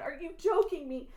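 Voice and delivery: normal talking voice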